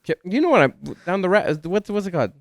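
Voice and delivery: laughing at own mixed-up idiom; flustered. Real, not acted. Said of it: "What am I saying?".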